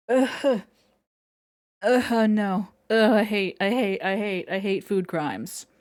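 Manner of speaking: groaning in disgust. Real, not acted. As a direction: utter revulsion at the idea. Disgusted at anchovy food choice.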